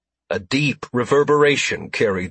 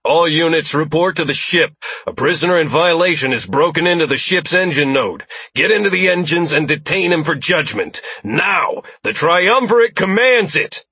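booming command; 7 semitones higher, 4 dB louder than usual. Acted. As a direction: boom it through the chamber. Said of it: "boomed through the chamber in Jared's voice".

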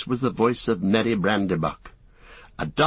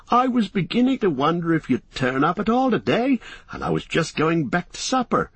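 put-on young hobbit voice; 7 semitones higher than usual. Merry voiced in story.

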